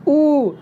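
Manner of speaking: "उ" said with tightened throat muscles; tense vowel demo. Shown as a demonstration. Teacher showing students a tense "उ", throat tightened. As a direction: strain the throat on "उ". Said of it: "मांसपेशियों पर खिंचाव आ रहा है".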